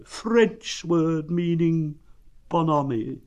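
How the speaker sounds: slow, high, gloomy explaining; doleful donkey giving a definition; Eeyore. A narrator's reading. Donkey explaining a French word to Pooh, gloomy. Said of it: "went on Eeyore gloomily".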